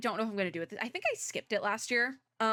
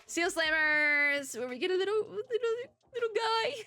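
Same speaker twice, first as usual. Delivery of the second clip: cutesy voice; 10 semitones higher than usual. Pretend. Cooing over tiny seal.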